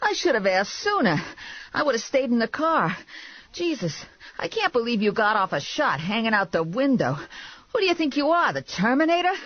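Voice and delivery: high, griping character voice; exasperated, scolding boss. A narrator's reading. Man berating her after the chase, exasperated. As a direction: scold in disbelief, pitch riding high.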